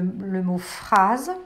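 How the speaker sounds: phrase drawn out slowly; slow model word. Shown as a demonstration. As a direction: stretch phrase so the children hear it. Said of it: "Par exemple".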